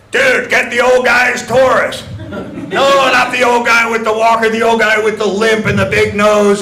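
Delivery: loud call over the intercom; shouting to the back over an intercom. Retold or imitated. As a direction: bark it through the store speaker.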